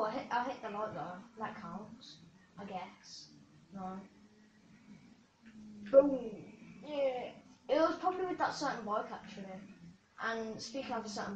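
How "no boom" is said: ordinary delivery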